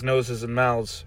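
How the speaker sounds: ordinary delivery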